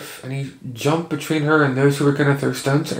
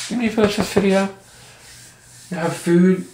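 a bit higher, gently pleading with the cat; light pet voice; 5 semitones higher than usual. Real, not acted. Talking to cat.

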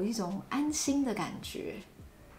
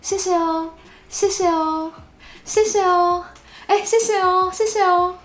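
bright, rising, louder thank-yous; happy Taiwanese thanks; 9 semitones higher, 9 dB louder than usual. Shown as a demonstration. Teacher demoing a cheerful Taiwanese thank you. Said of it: "That's very Taiwanese".

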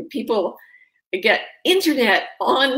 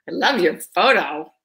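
ordinary talk

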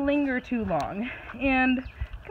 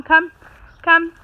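much louder, higher "come" commands; loud recall; 8 semitones higher, 10 dB louder, slower than usual. Real, not acted. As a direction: call the dog sharply back to you.

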